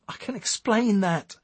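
high, flustered protest; stuttered. A narrator's reading. Eric caught with morphine by police, stuttering nervously. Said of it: "stuttered Eric".

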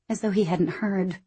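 plain reading voice

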